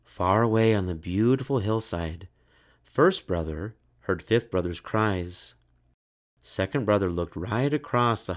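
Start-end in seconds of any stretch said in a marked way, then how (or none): none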